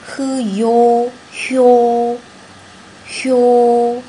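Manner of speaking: 呵哟哟 drawn out slowly, then 哟; sounding out words. Shown as a demonstration. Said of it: "再拼读一下".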